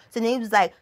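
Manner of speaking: ordinary delivery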